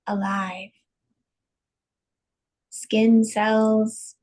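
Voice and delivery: slow, spacious meditation voice with long pauses; unhurried, evenly pitched breathing guidance; guided meditation. Acted. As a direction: guide listeners calmly into each breath.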